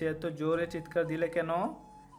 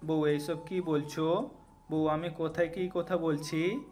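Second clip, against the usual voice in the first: low-voiced, bewildered husband; understated bafflement, pitched down; dumbfounded; 4 semitones lower than usual. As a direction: question her in low, mild disbelief. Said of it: "Ayaan হতভম্ব হয়ে বলল".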